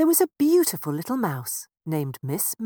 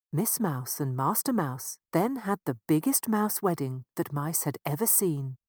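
ordinary delivery

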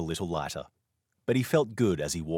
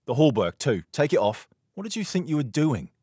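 louder, higher stern rebuke; rebuking; 7 semitones higher, 5 dB louder than usual. Acted. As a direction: cut the insolent boy short. Knight rebukes insolent boy.

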